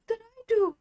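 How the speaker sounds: confused protest. Retold or imitated. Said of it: "And I'm like".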